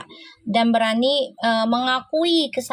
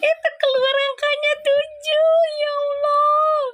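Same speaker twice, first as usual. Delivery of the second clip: high excited disbelief. Real, not acted. Still reacting to number seven.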